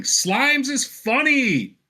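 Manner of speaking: put-on high, drawn-out kid's holler; child yelling. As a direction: call it out in a high little-girl voice, stretching the words. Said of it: "you hear her very loudly yelling".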